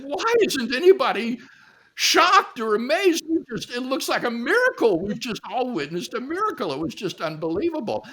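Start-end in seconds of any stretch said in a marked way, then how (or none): 0.0-6.7 high, loud, astonished protest; worked-up storyteller's incredulity; incredulous amazement. Retold or imitated. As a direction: retell it in astounded disbelief, speeding up. Retells his amazement at a miracle.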